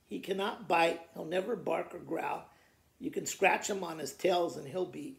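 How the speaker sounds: ordinary delivery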